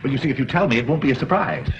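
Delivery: ordinary talk, nothing marked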